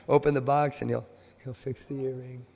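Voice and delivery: ordinary talk, nothing marked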